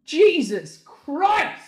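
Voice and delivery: high, loud, drawn-out exclamation; exasperated cry. Acted. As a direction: wail it out slowly in exasperation. Exclaims after the song.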